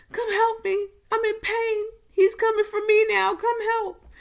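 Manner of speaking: high, loud desperate plea; a mother in pain begging for help; pleading mom. Retold or imitated. Mom begs Claire to come down, high loud plea.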